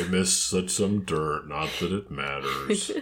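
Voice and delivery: low, slow, gloomy donkey voice; Eeyore. Acted. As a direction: mope it out, dragging and sinking. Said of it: "That's me doing Eeyore as a vacuum robot voice".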